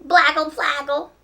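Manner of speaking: put-on high, loud baby babble. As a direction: babble like a toddler asking. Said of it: "asked the baby".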